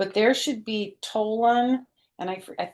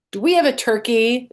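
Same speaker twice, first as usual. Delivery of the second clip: anxious demand; 6 semitones higher, 8 dB louder than usual. A narrator's reading. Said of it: "they asked".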